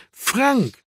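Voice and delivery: high-pitched call of a name. A narrator's reading. Marco summoning Frank inside the pub, calling out. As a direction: call for someone in the back. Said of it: "rief Marco".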